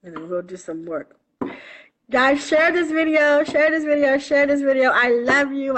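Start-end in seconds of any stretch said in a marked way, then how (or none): 2.1-5.8 higher and louder, pressing the request; eager urging. Real, not acted. Urging live viewers to share the video, eagerly. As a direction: push them to share it, over and over.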